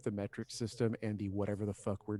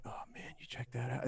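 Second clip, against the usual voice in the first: imitated sly aside, whispered; hushed, unvoiced mock whisper to a stranger; leaning-in whisper; 9 dB quieter than usual. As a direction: mimic a man confiding under his breath. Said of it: "they'll lean in and be like".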